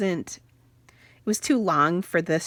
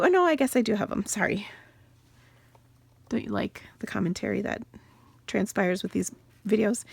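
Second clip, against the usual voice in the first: ordinary delivery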